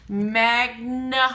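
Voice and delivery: "Magnifying" slow, broken after mag and nuh; sounding out. Shown as a demonstration. Teacher showing the middle sound, "Magnifying" slowly split. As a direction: punch the n beat in "Magnifying". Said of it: "in the middle".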